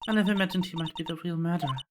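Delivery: usual speaking voice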